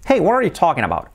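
"what are you" blurred into one, English vowels; native English accent. Shown as a demonstration. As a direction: imitate a fluent native's linked English. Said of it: "Cambia muchísimo".

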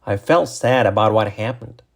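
connected example sentence; natural-speed model sentence. Shown as a demonstration. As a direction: say it in one flowing run.

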